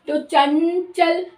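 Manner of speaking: चंचल drawn out, the nasal made at the palate before च; slow demo word. Shown as a demonstration. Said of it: "च जो है वो तालु से निकल कर आ रहा है".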